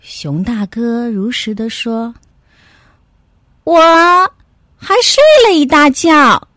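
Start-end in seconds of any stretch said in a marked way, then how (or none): 3.6-6.5 little dog confessing. Acted. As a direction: own up in a pitched-up dog voice.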